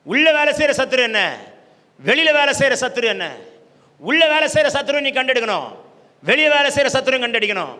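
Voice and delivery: loud, forceful rallying sermon; impassioned preacher's charge; full-force preaching. Real, not acted. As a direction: drive each line home in separate bursts.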